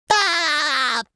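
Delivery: long, high cartoon cry; drawn-out high shout; sustained yelp. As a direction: let out a held "aah". Character's sign-off cry.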